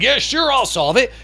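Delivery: mock the boss who grabs every problem; lightly voiced people-pleaser; eager pushover boss. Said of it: "And you're like".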